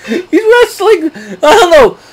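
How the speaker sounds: real laughter breaking through the words; chuckling while trying to get it out, high; laughing. Real, not acted. Laughing at Miz's antics.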